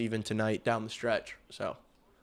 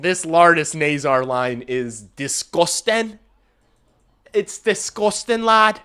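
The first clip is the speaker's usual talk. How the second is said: loud, high-pitched hype; excited raving; 6 semitones higher, 12 dB louder, slower than usual. Real, not acted. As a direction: rave about the line, louder and higher. Raving about the line.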